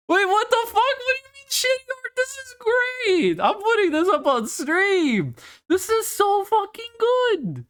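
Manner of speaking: real high-pitched gushing excitement; thrilled. Real, not acted. Streamer seeing fan art in the art channel, thrilled high gushing. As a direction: squeal with delight, voice way up high.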